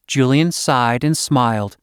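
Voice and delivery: plain reading voice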